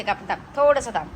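ordinary delivery

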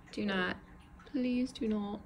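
softly begging the spider in a mock British accent; a put-on English accent, pleading; pretend UK accent. Pleading with the tarantula in a pretend UK accent. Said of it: "pretend U K accent".

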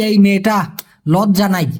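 ordinary talk, nothing marked

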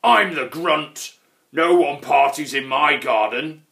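loud, growly troll warning; bellowing troll. A narrator's reading. Grunt warns a visitor out of his garden, bellowing. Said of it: "he bellowed".

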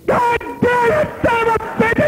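agent shouting. Retold or imitated. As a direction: let the calm old agent explode. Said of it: "and I hear".

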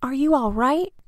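high, worried questioning call. A narrator's reading. Boy checks on Mister Carp underwater, high worried calling. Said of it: "I yelled".

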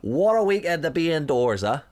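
a cheery Northern Irish butcher; imitating a Northern Irish butcher; Northern Irish accent. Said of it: "generic Northern Ireland accent".

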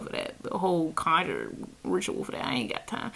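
unvoiced whisper, tossed off; whispered aside. Real, not acted. Shrugging off a petty theft in a whisper. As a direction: breathe it out as a dismissive aside.

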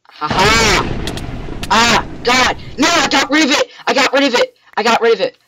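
high, very loud wail of loss; anguished screaming. Real, not acted. Gamer loses the prized sword, screaming in despair. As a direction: scream it like the worst loss ever.

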